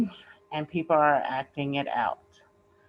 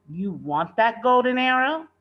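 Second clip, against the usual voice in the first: warningly; 6 semitones higher, 6 dB louder than usual. A narrator's reading.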